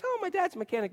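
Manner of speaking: put-on high, soft girl voice; lightly put-on girlish voice; imitated young girl. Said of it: "she says".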